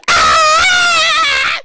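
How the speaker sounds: high loud excited yell; radio hype scream. Pretend. Host pictures himself on the radio, loud high hype scream. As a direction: shout it like a hyped radio host. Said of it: "now I'm on your radio station going".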